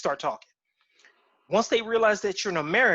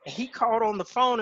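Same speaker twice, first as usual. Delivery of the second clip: normal talking voice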